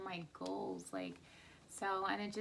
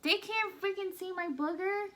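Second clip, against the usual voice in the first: annoyed; 9 semitones higher, 4 dB louder than usual. Real, not acted. Annoyed at husband's booger remark.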